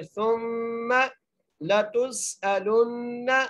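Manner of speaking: very slow chant, सुम्मा with a humming doubled meem; nasal ghunna. Shown as a demonstration. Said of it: "गुन्ना करेंगे".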